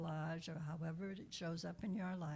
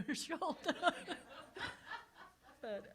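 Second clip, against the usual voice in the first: laughing; 9 semitones higher than usual. Real, not acted. Recalls crying, laughs.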